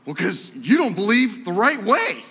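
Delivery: angry student at the lunch table; retold heated reply. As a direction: fire back defensively. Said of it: "get very angry".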